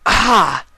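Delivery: high, loud yelp as the effort gives out; sharp cry of strain. Acted. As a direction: cry out sharply under the weight. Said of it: "crying with every bit the gap widened until".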